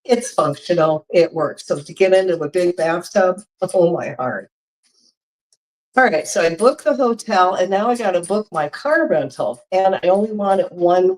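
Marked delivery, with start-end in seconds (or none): none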